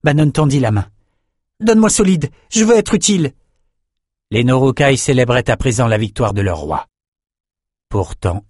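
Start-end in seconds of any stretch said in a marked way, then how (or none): 1.6-3.3 eager plea. A narrator's reading. Bannon begs for his sword.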